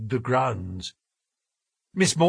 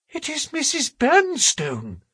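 high-pitched, anxious character voice; frightened Sholto; 14 semitones higher than usual. A narrator's reading. Sholto recognises the housekeeper's whimpering, high anxious voice. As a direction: voice the alarm up high.

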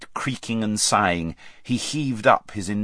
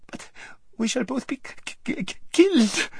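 very high, squeaky stutter; terrified stammer. A narrator's reading. Lazareline answering her friend's threat, stammering. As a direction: stammer on the k of "killed" in terror.

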